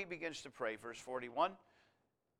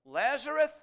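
loud, higher, drawn-out command; loud cry; 8 semitones higher, 10 dB louder, much slower than usual. Retold or imitated. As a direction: cry it out as a summons to the dead. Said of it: "he cried with a loud voice".

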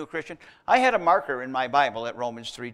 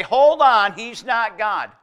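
raised, higher mock protest; loud objection; 7 semitones higher, 11 dB louder, slower than usual. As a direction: cut in loudly as an objector. Acted objection from a doubting listener.

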